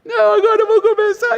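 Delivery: very high, loud mock-child wail; hammed-up squealing kid protest; child's tantrum. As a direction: wail like a brat starting a tantrum. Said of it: "E aí ele começa".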